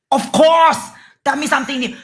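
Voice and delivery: high, sassy retort to a heckler; mock-delighted clapback; sassy comeback. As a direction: fire back loud and high, unbothered. Sassy comeback to retold insult.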